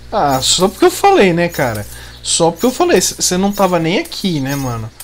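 loud exasperated complaining at shark; annoyed shout. Real, not acted. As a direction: yell at the shark, fed up. Shark attacks while he dives.

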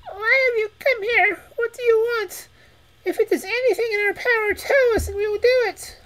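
very high, trembling rat voice; a frightened little rat pleading. Acted. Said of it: "said in a trembling voice".

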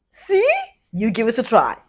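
usual speaking voice

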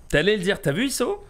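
loud, high excited call-out. Real, not acted. Streamer excitedly calls out to viewer.

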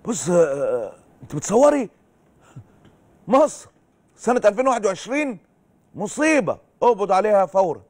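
sarcastic parody of a policeman arresting everyone; mock officer. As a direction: play a pompous officer shouting arrests. Host mocks arresting officer.

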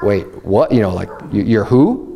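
low, halting disbelief; dumbfounded, hushed confusion; mock shock. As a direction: stammer it like you can't believe your ears. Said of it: "they're all shocked".

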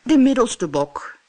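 mid-sized goat speaking; middle goat. Acted.

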